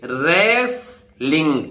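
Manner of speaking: रेसलिंग said slowly, no t after s; silent t. Shown as a demonstration. Teacher modelling for students: slow रेसलिंग, no t. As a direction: draw out रेसलिंग slowly with the t dropped. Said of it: "यहां टी silent है".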